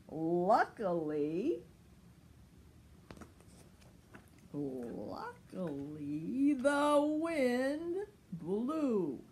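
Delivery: very slow, stretched refrain; slow sing-song storytelling; drawn out. A narrator's reading. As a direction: stretch each word out dreamily.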